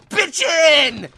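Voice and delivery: high, very loud holler; excited yell. Acted. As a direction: belt it out, pitch jumping up. Second take of shouted line.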